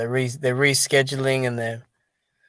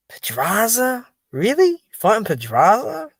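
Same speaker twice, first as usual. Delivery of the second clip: can't believe the matchup, voice shooting higher; incredulous disbelief; 8 semitones higher, slower than usual. Real, not acted. Streamer to viewers, stunned at the fight news, incredulous and higher. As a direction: pitch jumps way up in disbelief.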